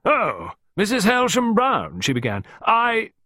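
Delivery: high, loud, drawn-out character voice; hearty lady gardener. A narrator's reading. Miss Peake arriving at the French windows, hearty. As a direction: burst in with a loud, high greeting.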